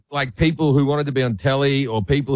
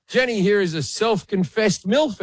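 higher, slow game show host proclaiming; mock host announcement; 6 semitones higher than usual. Host announcing a contestant to the audience, slow and higher. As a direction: announce it to the whole studio. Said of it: "And I went".

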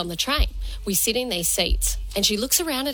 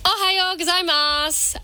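Japanese greeting with an Australian twang, slow and higher; Australian-accented Japanese; 7 semitones higher than usual. Real, not acted. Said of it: "Nice little Australian twang there on her Japanese".

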